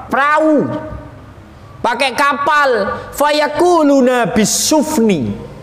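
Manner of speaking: high, projected acted replies; souls answering. Souls answer the angel about crossing seas, high acted voice. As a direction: answer up high like eager respondents.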